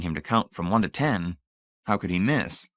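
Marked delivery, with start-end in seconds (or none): none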